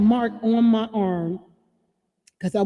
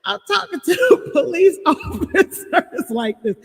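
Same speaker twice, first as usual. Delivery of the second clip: laughing hard, high and loud, then talking through it; real laughter spilling into the words; breaking up laughing mid-story; 7 semitones higher, 4 dB louder, slower than usual. Real, not acted. Laughing at her own story.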